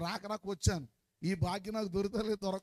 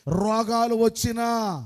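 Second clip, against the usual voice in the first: loud call to repeat; 4 semitones higher, 11 dB louder, slower than usual. Shown as a demonstration. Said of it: "కొంచెం గట్టిగా చెప్పకూడదా?".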